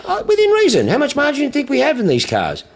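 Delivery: high-pitched indignant pushback; mock protest. Dealer argues margin with the caller, mock protest. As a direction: push the voice right up, protesting to the caller.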